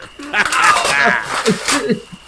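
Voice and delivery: cruel laugh. Acted. Bully laughing at Pendle while breaking his guitar, cruel laugh.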